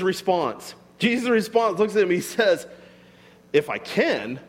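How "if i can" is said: "can" swooped up as an incredulous question; questioning echo. Retold or imitated. Jesus answering the father, incredulous on "can". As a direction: throw "can" back as a challenge, pitch leaping. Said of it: "notice the question mark".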